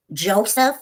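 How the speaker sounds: lightly mock her lilting "Joseph"; mimicking her talk. Showing how a rival talks, mocking mimicry. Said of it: "That's how she talks".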